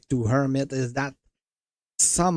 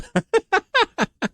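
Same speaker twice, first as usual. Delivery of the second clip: surprised laugh; 6 dB louder than usual. Real, not acted. Laugh after a bad result.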